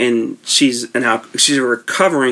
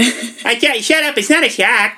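high put-on voice for a quoted movie line; movie character impression; 10 semitones higher than usual. Podcaster finally doing the movie line, high put-on voice. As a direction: pitch it way up and mock the character. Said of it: "I was waiting to do that for a while".

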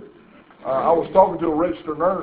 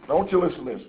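normal talking voice

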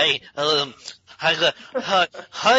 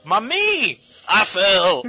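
comic cry of distress; fake-horrified yelp; mock panic; 2 semitones higher, 3 dB louder, slower than usual. Dubbing a panicked film character.

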